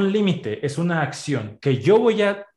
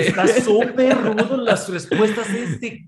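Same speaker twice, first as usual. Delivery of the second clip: laughing; 6 semitones higher, 4 dB louder, slower than usual. Real, not acted. Laughing about rude advice.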